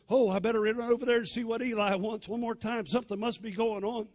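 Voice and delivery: high, loud, keyed-up talking to oneself; eager hurry. Retold or imitated. Boy wakes and decides to run to Eli, eager. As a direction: rouse yourself and rush to help. Boy rouses, hurries to master.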